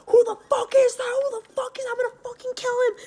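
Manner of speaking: high-pitched squealed imitation of a screaming man; mock screaming. Imitating stepdad charging down the driveway, mock screaming.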